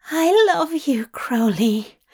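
high, loud cry trailing into breath; tearful cry. A narrator's reading. Angel moved by lover's words, crying out tearfully. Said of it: "Zira cried, moved to tears at once".

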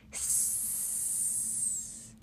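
long soft hiss; sustained sibilant snake sound. Acted. Snake lurking near Albie in dark, long soft hiss.

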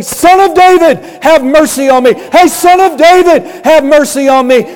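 high, loud pleading call; desperate crying out. Retold or imitated. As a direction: call out over a crowd, begging. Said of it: "you gotta want it so bad, you gotta say".

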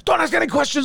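high-pitched mock scream; retold screaming. Host imitating an enraged scammer on the phone, high mock scream. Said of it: "started screaming".

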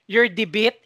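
"debit" said as "dee-BIT", rising; questioning "dee-bit" for "debit"; mispronounced debit. Retold or imitated. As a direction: say "debit" with ee first, as if unsure. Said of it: "naka-apat na beses siyang mali".

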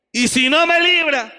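shouted high and loud in defiance; defiant shout. Acted. Preacher voicing the youths defying the king, shouting. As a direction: hurl it back at the king.

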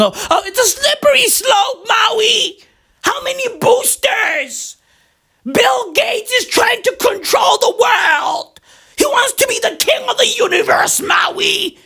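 high-pitched mock alarmist griping; mock complaining. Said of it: "Motherfuckers be out here complaining".

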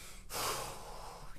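exasperated sigh. Retold or imitated. Wife reacting silently.

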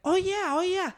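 brushing off the stranger with a lifted oh yeah; mock agreement. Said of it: "I'm like".

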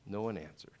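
usual speaking voice

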